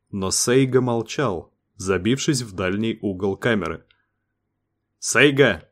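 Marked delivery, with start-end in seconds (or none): 5.0-5.7 sharp call. A narrator's reading. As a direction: snap his name out, a touch raised. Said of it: "прикрикнул Дэйв".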